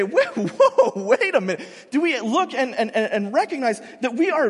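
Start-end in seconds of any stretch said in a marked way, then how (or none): 0.0-1.7 high, louder laughing whoa; laughing mock surprise. Imagining people noticing their privilege, with laughing surprise. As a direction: stop short, half laughing. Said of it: "do we ever look and say".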